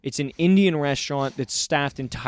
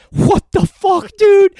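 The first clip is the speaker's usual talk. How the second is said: much higher, loud disgusted yell; mock outraged teen; 10 dB louder, slower than usual. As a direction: yell it like a horrified teenager. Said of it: "I just hear his friend, son go".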